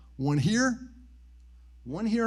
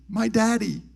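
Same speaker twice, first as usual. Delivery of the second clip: put-on small boy; 6 semitones higher, slower than usual. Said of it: "And he says".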